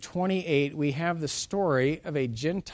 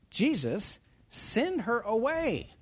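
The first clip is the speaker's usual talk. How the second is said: higher, drawn-out annoyed complaint; exasperated; 8 semitones higher, slower than usual. Acted. Disciples complain to Jesus about the woman, exasperated. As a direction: voice the fed-up disciples, stretching the words. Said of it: "they're exasperated. This is annoying".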